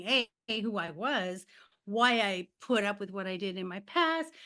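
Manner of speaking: ordinary delivery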